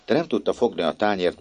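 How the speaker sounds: plain reading voice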